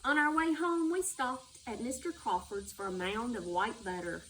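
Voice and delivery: ordinary delivery, nothing marked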